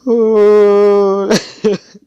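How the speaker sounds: a real, high, loud burst of laughter that takes over; helpless shrieking laugh. Real, not acted. Telling the anime scene to listeners and losing it, loud high laugh.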